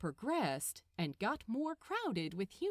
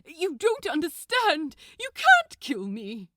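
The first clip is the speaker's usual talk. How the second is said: loud desperate pleading angel; loud protest; 6 semitones higher, 13 dB louder than usual. Acted. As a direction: protest in panic, near shouting. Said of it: "he protested".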